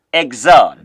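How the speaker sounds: Exode said "eg-ZOD"; low, firm Exode, second syllable falling; French pronunciation. Shown as a demonstration. Teacher shows beginners how French says it, Exode as "eg-ZOD". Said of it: "It's pronounced".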